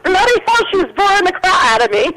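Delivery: high, hammed-up fake British accent; mock British accent. Teasing the British co-host, mock British accent. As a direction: camp it up like a cartoon Brit. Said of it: "I wish I had a, a British accent".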